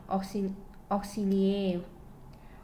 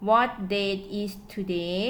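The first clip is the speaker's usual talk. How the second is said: slow model sentence; much slower than usual. Shown as a demonstration. Teacher to students giving an example, very slow. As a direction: read it out slowly for learners to copy. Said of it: "ตัวอย่างคือ".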